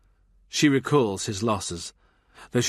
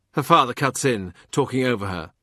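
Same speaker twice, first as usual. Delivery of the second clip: ordinary delivery, nothing marked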